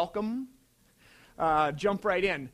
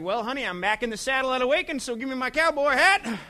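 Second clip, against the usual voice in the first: hammed-up bravado; 6 semitones higher, 8 dB louder than usual. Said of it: "And I'm like".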